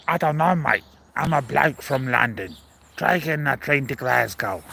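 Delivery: hammed-up Cockney bloke from London; Cockney accent. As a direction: put on your best Cockney for a train passenger. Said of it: "That's my best Cockney accent".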